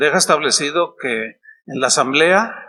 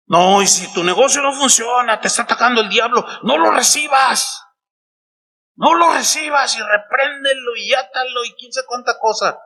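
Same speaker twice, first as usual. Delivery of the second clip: hammed-up preacher shouting at the devil, higher; mock prosperity preacher; 6 semitones higher than usual. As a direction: rant like a televangelist rebuking the devil. Mocks prosperity preachers' rebuking. (burla de predicadores de prosperidad, gritando más agudo)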